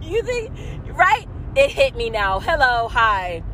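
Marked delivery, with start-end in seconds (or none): none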